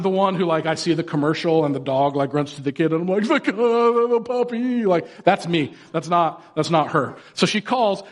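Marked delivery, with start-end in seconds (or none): 3.2-4.8 getting misty at a puppy ad; hammed-up tender gush; mock sentimental. He tears up at commercial.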